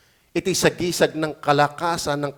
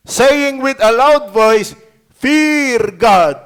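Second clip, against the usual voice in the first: proclaimed reading; 4 semitones higher, 6 dB louder, much slower than usual. Acted.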